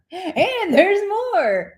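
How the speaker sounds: high, slow, laughing pitchman; infomercial voice. Shown as a demonstration. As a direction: stretch it like a TV ad. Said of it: "We sound like an infomercial".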